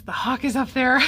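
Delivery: sudden thrill at spotting the bird; excited call-out. Real, not acted. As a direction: call out the hawk with real excitement.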